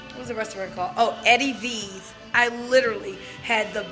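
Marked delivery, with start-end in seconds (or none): none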